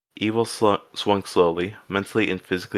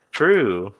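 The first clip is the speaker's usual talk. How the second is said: a firm, louder "True." falling at the end; emphatic agreement; 3 semitones higher, 4 dB louder than usual. Real, not acted. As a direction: agree with it firmly. Agreeing with own joke.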